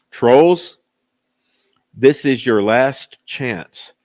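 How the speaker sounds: loud, slow, stern warning. Real, not acted. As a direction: lay down the law to the trolls.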